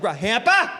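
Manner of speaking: high, loud repeated nagging; husband mimicking wives nagging about the hamper; mocking nag. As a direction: hammer the word like a nag.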